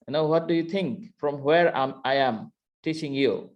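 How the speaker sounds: ordinary delivery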